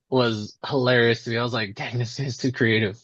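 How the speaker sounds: usual speaking voice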